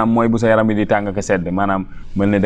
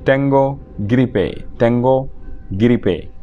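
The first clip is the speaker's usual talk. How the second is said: "Tengo gripe" slow, with a gap between words; slow model pronunciation; slower than usual. Shown as a demonstration. Teacher models a phrase for learners, slow and spaced. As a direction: say "Tengo gripe" slowly, word by word.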